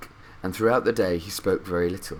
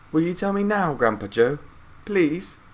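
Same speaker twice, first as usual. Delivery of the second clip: put-on a higher boy's voice, asking sweetly; Charlie pleading; 9 semitones higher than usual. A narrator's reading. Charlie begging Grandpa Joe for the story, sweet boy's voice. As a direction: beg like a polite little boy.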